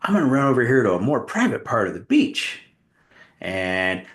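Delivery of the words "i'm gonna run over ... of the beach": put-on coy girl voice. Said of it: "and she goes".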